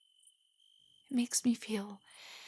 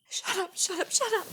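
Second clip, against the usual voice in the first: higher, louder embarrassed yelp rising to a squeal; flustered shout; 8 semitones higher, 5 dB louder than usual. Acted. Girl embarrassed by her date's compliment, squealing shut up. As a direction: cry it out, mortified by the compliment.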